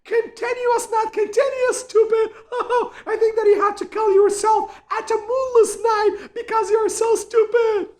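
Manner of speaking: high, loud, jeering laughter-filled voice; squealing, hammed-up mockery; mocking ridicule. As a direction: shriek with scornful laughter, making a fool of them. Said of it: "the one who was just mocking at you".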